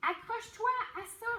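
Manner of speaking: high urgent shout, fast; Clovy shouting high and fast. Acted. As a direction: yell it across the lake. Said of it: "crie Clovy".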